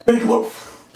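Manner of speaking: loud goofy squeaky take on big loaf. Shown as a demonstration. Repeating "Big loaf" in a loud silly voice.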